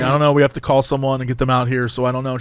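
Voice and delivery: low, hesitant cashier; drive-through guy. Retold or imitated. Cashier answering an angry customer, low and subdued. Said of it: "He's like".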